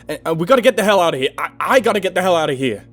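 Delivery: high, loud, rushed pleading; panicked shouting. A narrator's reading. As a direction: babble it out in terror. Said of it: "I shouted at him".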